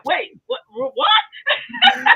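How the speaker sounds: high, startled double take; baffled squeal in retelling; mock astonishment. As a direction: act out a shocked what, shooting up high. Acts out her surprised reaction.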